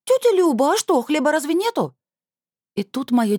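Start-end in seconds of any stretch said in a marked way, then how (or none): 0.0-1.9 put-on high, loud girlish shout; shrill call to aunt from the bread bin. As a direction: yell it across the kitchen. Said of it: "и закричала".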